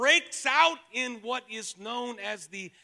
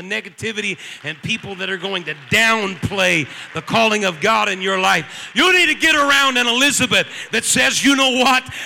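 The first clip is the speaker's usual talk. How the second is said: loud, rising rallying sermon shout; full-force preaching; 4 semitones higher, 15 dB louder than usual. Real, not acted. Preacher urging congregation to find an Elizabeth, full-force preaching.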